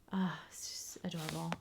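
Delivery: breathy whisper of delight; soft whispered adoration. Real, not acted. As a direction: whisper it like you're charmed. Admiring villager's house.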